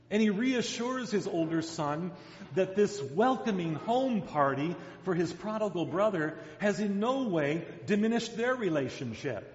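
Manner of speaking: ordinary delivery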